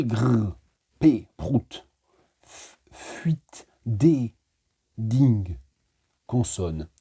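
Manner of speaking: playful, rhythmic recitation with sound effects; poem reading. Acted. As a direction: savour each consonant as you read. Reads the Consonne poem. (lecture de poème ludique et sonore)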